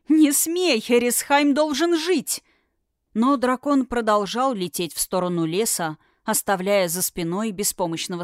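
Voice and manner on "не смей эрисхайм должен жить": high loud pleading shout; desperate shout. A narrator's reading. Princess screams at dragon.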